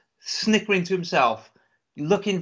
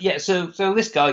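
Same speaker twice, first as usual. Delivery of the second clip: ordinary delivery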